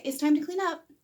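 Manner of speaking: "It's time to clean up" light and high; kind tone. Shown as a demonstration. Parent kindly telling the child to tidy. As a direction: sound kind, like good news. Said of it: "you can say it in a very kind way".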